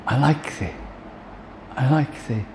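hushed, small-voiced confession; humbled reply. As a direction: answer the Lord meekly and quietly. Acts Peter's humbled answer to Jesus.

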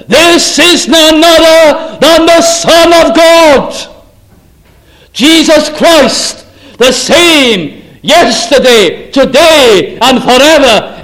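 slow, loud, ringing proclamation of Christ; full-force preaching. Acted. Preacher to congregation, slow, loud and ringing. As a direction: hammer each phrase home from the pulpit.

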